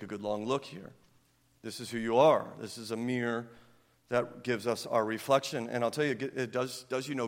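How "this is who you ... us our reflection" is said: ordinary talk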